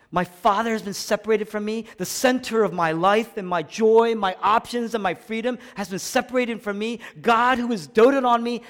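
high, pressing, impassioned lament; acted anguish, preached with fervour; anguished outpouring. Preacher voicing Jesus's anguish on the cross.

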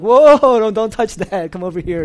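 sudden loud high "Whoa", then settling; startled cry at a child touching things; fussy host yelping at kids; alarmed warning. Retold or imitated. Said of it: "and you're like".

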